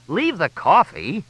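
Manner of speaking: high, drawn-out command; stretched-out, high-pitched warning; sharp order. A narrator's reading. Fletch stops Crystal grabbing his coffee.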